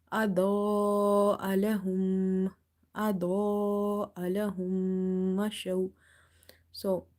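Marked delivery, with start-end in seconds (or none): 0.1-5.9 slow, level recitation with أضاء held long; stretched madd. Shown as a demonstration. Teacher shows two and a half alif stretch, long-held recitation. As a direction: stretch the second vowel of أضاء right out. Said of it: "you will stretch up to two and a half alif".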